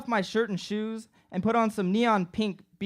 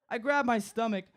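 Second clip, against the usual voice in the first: ordinary delivery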